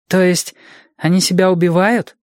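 put-on high child's voice, taken aback; high-pitched boy working it out; puzzled little boy. A narrator's reading. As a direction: ask it as a startled small boy.

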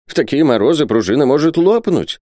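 high, fretful warning; anxious babbling. Acted. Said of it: "залепетал Исаак Хакабут". (тревожный лепет, высоким голосом)

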